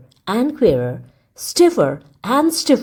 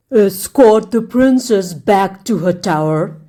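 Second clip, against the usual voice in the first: wicked king; 4 dB louder than usual. A narrator's reading. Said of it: "he commanded".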